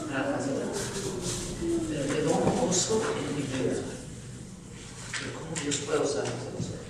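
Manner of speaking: soft, breaking, nearly whispered; words caught in tears; choked up. Real, not acted. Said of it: "salí llorando". (voz quebrada y casi susurrada)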